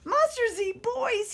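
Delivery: high, loud, giggling; laughing cry. A narrator's reading. Monster laughing on the floor, high loud. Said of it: "he cried".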